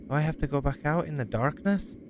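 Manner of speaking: ordinary delivery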